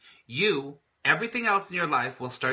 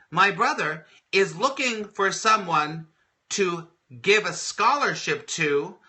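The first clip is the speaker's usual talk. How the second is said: higher, louder, slowed eager pitch; excited coworker; 4 semitones higher, 4 dB louder, slower than usual. Retold or imitated. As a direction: sell the amazing offer with glee.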